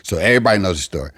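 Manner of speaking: ordinary talk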